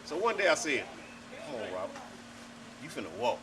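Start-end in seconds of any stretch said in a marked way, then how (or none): 1.5-3.4 low, soft retold coaxing; gentle coaxing. Retelling encouraging Robert to walk, soft coaxing. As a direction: coax a toddler quietly, keeping it low.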